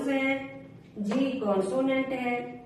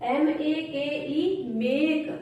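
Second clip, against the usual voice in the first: ordinary talk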